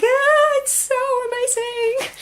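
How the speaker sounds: very high, swooning gasp of wonder; answering her child, swooning high with delight; breathless amazement. Retold or imitated. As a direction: sigh it out overwhelmed with delight. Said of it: "I did sound like that then".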